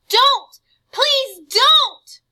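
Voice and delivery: high, loud panicked begging; boy screaming in terror; terrified sobbing plea. Acted. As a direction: beg for your life through tears. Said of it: "Wendell was sobbing as he ran".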